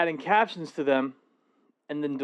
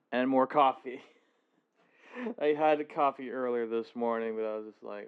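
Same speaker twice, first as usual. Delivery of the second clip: ordinary talk, nothing marked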